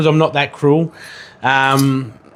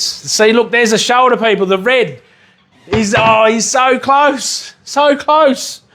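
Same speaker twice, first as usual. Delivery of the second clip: excited shouting; 7 semitones higher, slower than usual. Real, not acted. Dog nearly caught on screen.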